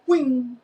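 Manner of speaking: high, loud zinging whoosh; zing it like something flying past; imitated whizzing noise. Chick rails by the rider, imitated whizzing noise.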